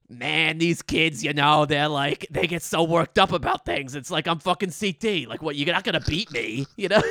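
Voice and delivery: chilled-out veteran champ, casually cocky; C T impression. Mimicking an older champ on worked-up kids, chill C T. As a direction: shrug off the young challengers, amused. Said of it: "like, kinda chill".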